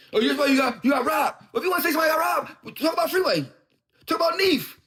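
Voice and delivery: loud, high, heated call-out; heated shout. Real, not acted. Rapper shouting down a rival about getting robbed, loud and heated. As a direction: yell it at him like you mean it.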